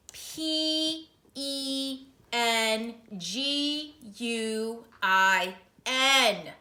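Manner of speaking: letter by letter, very slow; very slow spelling; spelling slowly. Acted. Spelling for the clerk.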